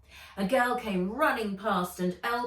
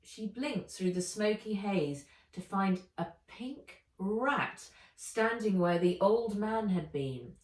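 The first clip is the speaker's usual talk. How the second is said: usual speaking voice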